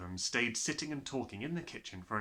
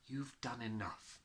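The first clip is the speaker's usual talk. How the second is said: Miss Honey; 8 dB quieter, slower than usual. Acted.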